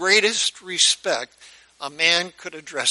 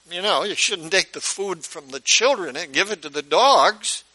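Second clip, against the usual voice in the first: ordinary talk, nothing marked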